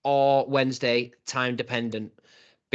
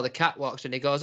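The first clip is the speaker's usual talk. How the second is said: ordinary delivery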